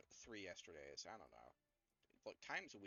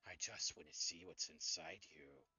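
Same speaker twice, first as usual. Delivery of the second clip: creepy imitation; 5 semitones lower than usual. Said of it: "Flashbacks to a fucking certain blue dragonborn".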